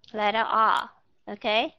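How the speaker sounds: ordinary talk, nothing marked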